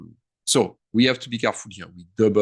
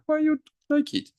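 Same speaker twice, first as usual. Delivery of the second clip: mock squeaky complaint. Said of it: "I'm like".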